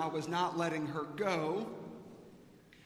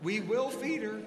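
higher, bright-eyed teacher reassurance; pep talk; 8 semitones higher than usual. Retold or imitated. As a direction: cheer up a nervous dad, lightly voiced. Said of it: "giving me a pep talk".

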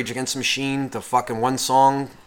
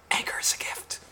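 soft, voiceless whisper into the mic; 12 dB quieter than usual. Retold or imitated. Imitating the singer before the song goes insane, whispered. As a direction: drop to a close whisper. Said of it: "Zach fucking literally just whispers".

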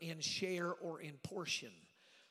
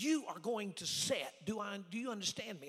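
stern order; 4 semitones higher, 3 dB louder than usual. Retold or imitated. Bishop ordering the young preacher to sit, stern. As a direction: imitate a bishop laying down the law.

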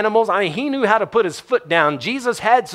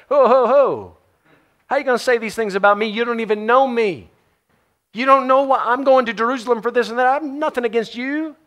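indignant protest; 6 semitones higher than usual. Pretend. Offended person protesting accusations.

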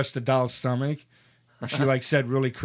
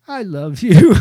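loud, higher toy doll voice blurting out; talking doll voice; 5 semitones higher, 13 dB louder, slower than usual. Retold or imitated. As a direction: play the creepy doll going off mid-fall. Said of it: "the doll goes".

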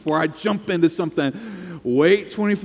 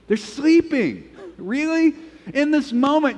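can you believe it, pitched way up; incredulous; 9 semitones higher than usual. Real, not acted. Preacher incredulous about sleeping disciples.

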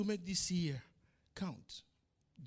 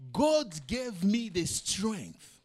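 drawn out and louder, higher, each word weighted; slow loud proclamation; 4 semitones higher, 8 dB louder, much slower than usual. Acted. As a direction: proclaim it to the congregation. Testimony climax in sermon.

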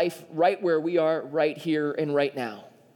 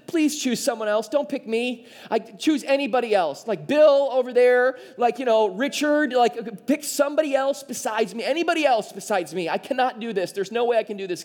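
higher-pitched, hammed-up pleading whine; Moses whining; 5 semitones higher, 3 dB louder than usual. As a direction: beg to be passed over, whining. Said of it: "envision him whining while saying this".

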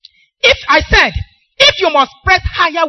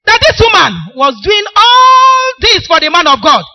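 preaching emphasis; 4 semitones higher, 6 dB louder than usual. Real, not acted.

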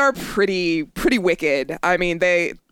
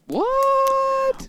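very high squeal of disbelief; high-pitched incredulous "what"; shocked what. Acted. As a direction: act out stunned disbelief in a shriek-high voice. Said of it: "I'd be like".

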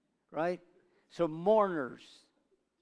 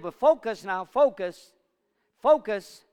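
each focus louder and higher; building urge; 5 semitones higher, 11 dB louder than usual. Acted. Jesus pressing Jairus, growing louder each time.

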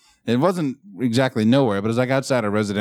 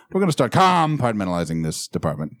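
"compartmentalizing" with COM punched and stretched; oddly heavy COM at the start of "compartmentalizing"; bizarre emphasis. Shown as a demonstration. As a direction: hit the first syllable of "compartmentalizing" far too hard. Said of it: "stick the emphasis in a really bizarre spot".

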